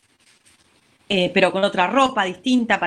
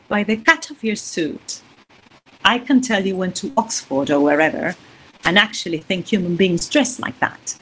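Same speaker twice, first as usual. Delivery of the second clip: slow intimate English line to the man beside her; right way; 3 semitones lower than usual. Shown as a demonstration. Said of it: "Eh, es otra cosa".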